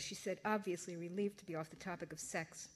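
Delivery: usual speaking voice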